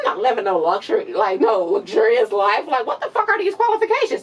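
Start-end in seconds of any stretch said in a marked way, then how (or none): 2.7-4.2 exasperated. Real, not acted. Exasperated rant about qualifications.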